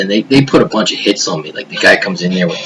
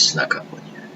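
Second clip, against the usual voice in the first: silent inner voice; 8 dB quieter than usual. Retold or imitated. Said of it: "the silent voice in my head says".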